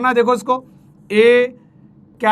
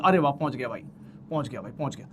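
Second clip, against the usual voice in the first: pleased aside; 8 semitones lower, 12 dB quieter, faster than usual. Real, not acted. Reaching the end point aside.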